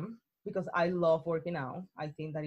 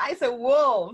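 slow, louder, through real laughter; laughing; 3 semitones higher, 7 dB louder, slower than usual. Real, not acted. Joking with her host about a growling gym guy, laughing. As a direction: crack up while saying it.

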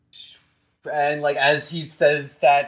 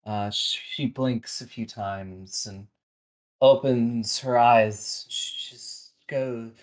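ordinary delivery

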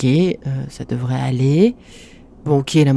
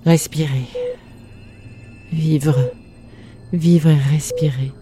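poetic narration; 3 dB quieter than usual. A narrator's reading. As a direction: let each phrase sink in alone.

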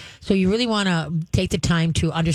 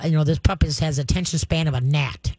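ordinary talk, nothing marked

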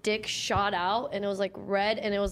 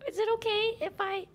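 much higher, small voice; timid asking voice; 12 semitones higher than usual. Acted. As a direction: ask permission in a tiny high voice. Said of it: "that's something I would say".

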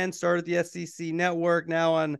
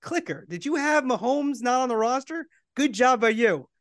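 lightly imitated manager, pitched higher; Bobby V; 7 semitones higher than usual. Manager opening a press conference by addressing a reporter, lightly imitated. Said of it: "Bobby V started the press conference, go".

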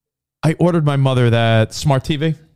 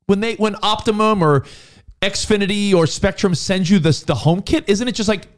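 incredulous retort; 8 semitones higher than usual. Retold or imitated. Sibling telling brother a self setup is easy, incredulous. Retelling his exasperated challenge to brother.

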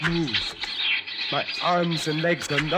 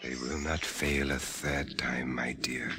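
low hushed whisper; 9 dB quieter than usual. Acted. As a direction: whisper so the jailers can't hear. Whispering while watched.

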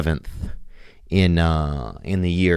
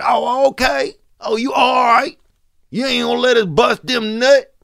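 very high-pitched caricature of indignation; 3 dB louder than usual. Retold or imitated. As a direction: shoot way up high, mock-offended.

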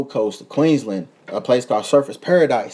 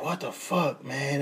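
much softer, under the breath; muttered complaint; 13 dB quieter, slower than usual. Retold or imitated. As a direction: grumble it quietly to yourself. Grumbling about the heat.

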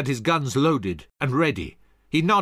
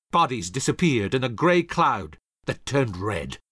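usual speaking voice

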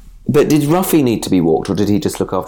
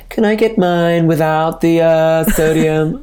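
put-on American accent; 6 semitones higher than usual. Customer fussing over vaccine ingredients, in a higher American accent. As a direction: put on a really American customer, stretching the words. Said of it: "like really American".

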